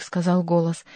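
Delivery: ordinary delivery, nothing marked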